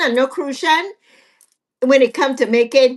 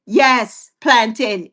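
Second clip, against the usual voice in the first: ordinary talk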